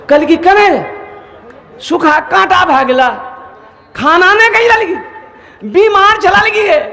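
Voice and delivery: high, startled outcry from the brothers; alarmed brothers. Acted. Brothers shocked at their thin sister, high alarmed voice. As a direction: gasp at how thin she has grown.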